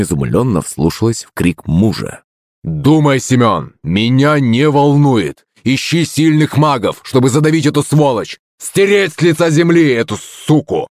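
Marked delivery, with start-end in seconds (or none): 2.6-10.9 put-on drunken shouting. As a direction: bellow like a furious drunk. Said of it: "кричал он пьяным голосом".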